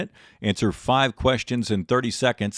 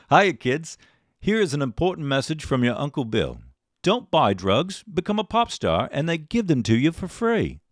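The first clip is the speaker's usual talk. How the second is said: higher, swooping fake British voice; bad British accent; 5 semitones higher than usual. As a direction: ham up a bad British accent for a film quote. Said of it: "That's the worst accent I do".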